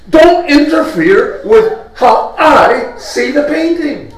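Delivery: indignantly insist, slowing on the last words; indignant art lover. Acted. Viewer who likes the horse rebukes his corrector, indignant. Man annoyed at being corrected, acted.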